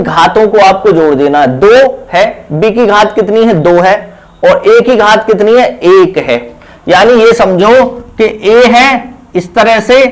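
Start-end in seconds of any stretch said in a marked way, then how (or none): none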